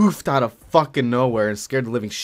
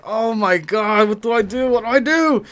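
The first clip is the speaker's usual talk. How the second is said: panicking; 9 semitones higher than usual. Retold or imitated. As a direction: beg for help in a rising panic. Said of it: "It was like".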